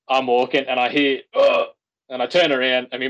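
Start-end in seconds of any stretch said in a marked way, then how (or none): 1.3-1.7 imitated pained groan. Mate falls, retold groan.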